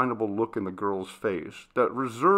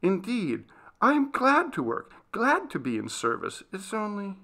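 insist, then falter on the last words; a touch of breaking down; 11 semitones higher than usual. Acted. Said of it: "she faltered and broke down".

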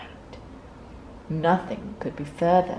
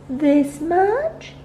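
high, bright little question; excited little bunny; eager child voice; 10 semitones higher, 6 dB louder than usual. A narrator's reading. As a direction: stretch it up like a small child showing off.

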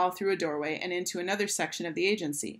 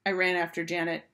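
ordinary delivery

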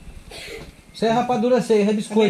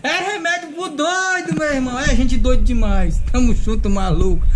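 high, drawn-out, then loud; hyped sign-off; 4 semitones higher, 4 dB louder than usual. Real, not acted. Prankster signing off after teasing his aunt, hyped and loud. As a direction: crow it out to the viewers.

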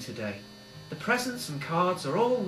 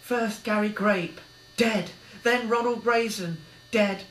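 builds high and loud in anger; Billy condemning Arnie's murders; rising fury; 6 semitones higher, 3 dB louder than usual. A narrator's reading. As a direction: turn on the killer with hate. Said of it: "sensed a change of tone".

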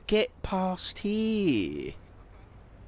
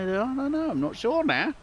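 ordinary delivery

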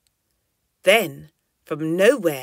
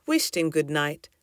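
usual speaking voice

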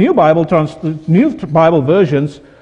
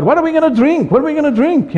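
high-pitched imitation of complainers; mock grumbling; 8 semitones higher than usual. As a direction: mimic a crowd's complaint, pitched well up. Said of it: "they start murmuring about".

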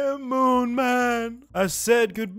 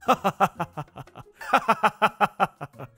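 sinister villain cackle; evil laugh; 4 semitones higher than usual. Pretend. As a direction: cackle like a devil.

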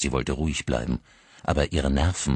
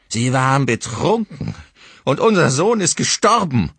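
shrill bitter accusation, much higher and louder; 10 semitones higher, 8 dB louder than usual. A narrator's reading. Grieving mother accuses the drunk driver, bitter and shrill. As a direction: hurl it at her bitterly. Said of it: "fügte seine Frau verbittert hinzu".

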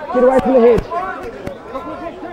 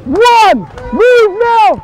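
shouted calls; 6 semitones higher than usual. Real, not acted. Referee calling over the play.

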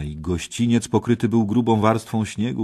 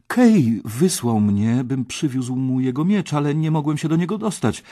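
higher voice, gathering speed; frightened explanation; 6 semitones higher, 5 dB louder than usual. A narrator's reading. Artur fears he did wrong and explains, anxious hurrying. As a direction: hurry out your excuse anxiously. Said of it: "zląkłszy się, że postąpił źle".

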